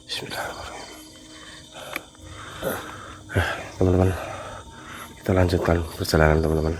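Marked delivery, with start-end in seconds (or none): 0.1-0.9 barely voiced basmala under the breath; whispered prayer. Real, not acted. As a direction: whisper the bismillah to yourself. Whispered prayer before walking on. (bismillah dibisikkan pelan)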